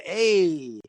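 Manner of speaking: pointing-at-you catchphrase impression; Arthur Fonzarelli. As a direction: imitate a sitcom greaser's drawn-out greeting. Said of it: "like a Arthur Fonzarelli kinda".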